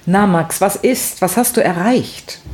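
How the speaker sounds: mildly worried wife pressing for news. A narrator's reading. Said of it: "Sie war sehr besorgt".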